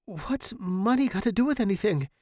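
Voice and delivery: high, earnest reassurance; impassioned devotion. A narrator's reading. Student to dying teacher, earnest devotion. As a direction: plead earnestly, softening by the end.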